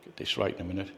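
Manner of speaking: ordinary delivery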